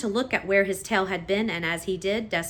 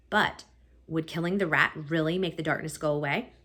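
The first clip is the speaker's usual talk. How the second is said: ordinary delivery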